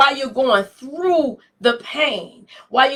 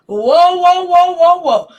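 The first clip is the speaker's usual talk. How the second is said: loud whoa cries; 6 semitones higher, 6 dB louder than usual. Real, not acted. Preacher reliving her pain to the congregation, loud whoa cries. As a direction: let the whoas ring out loud.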